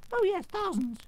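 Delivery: very high little royal squeak; squeaky mouse queen. A narrator's reading. Mouse queen explaining her rescue, squeaky. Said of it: "in a squeaky little voice".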